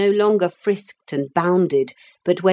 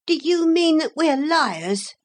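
hot indignation; 11 semitones higher than usual. A narrator's reading. Philip challenging the motor lady's accusation, hotly. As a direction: challenge her hotly in a raised pitch. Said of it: "Philip asked hotly".

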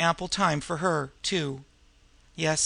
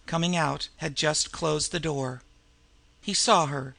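ordinary delivery, nothing marked

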